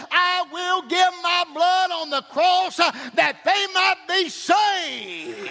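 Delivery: high, fervent sermon cadence; full-force preaching. Real, not acted. Preacher telling how the Savior outbid Satan, fervent preaching. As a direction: drive each phrase home, high-pitched.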